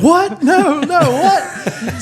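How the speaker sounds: high, loud, disbelieving outburst; mock panic. Boyfriend on the chairlift hears he is dumped, mock panic. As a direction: imitate a panicked boyfriend protesting.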